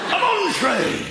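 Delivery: Northern 'on train' called out slow. Retold or imitated. Northern dad shouting down the phone, slow Northern. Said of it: "In the North, you like to get to the heart".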